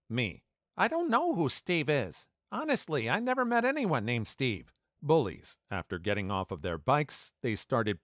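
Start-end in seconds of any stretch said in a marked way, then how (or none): 0.8-4.7 put-on much higher, small kid's voice; pleading kid. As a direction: plead your innocence in a child's voice. Scared kid denies to bullies.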